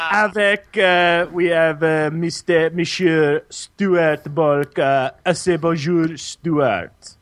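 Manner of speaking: slow, hammy French presenter; French accent. Acted. Host introduces his co-host, put-on French accent. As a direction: introduce a guest in halting mock French.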